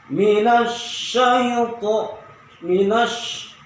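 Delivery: minasy with a long airy sh, chanted slow and high; breathy shin. Shown as a demonstration. Teacher chanting minasy to show air escaping. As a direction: stretch the sh in minasy and let the air flow. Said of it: "ada angin yang keluar".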